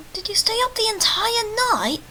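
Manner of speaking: high, whiny just-woken voice; groggy voice. Acted. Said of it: "That's my groggy voice".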